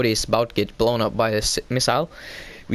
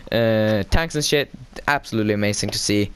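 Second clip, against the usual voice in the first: normal talking voice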